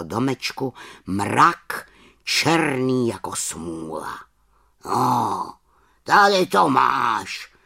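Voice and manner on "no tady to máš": slow, loud croak; croaking raven. A narrator's reading. Raven Abraxas croaks at the witch, slow and loud. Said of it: "zakrákal Abraxas".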